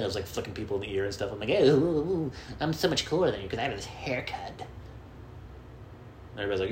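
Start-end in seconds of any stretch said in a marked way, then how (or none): 1.5-4.7 higher teasing singsong; taunting kids on the bus; show-off taunt. Retold or imitated. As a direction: brag like a smug kid. Boasting kid taunting.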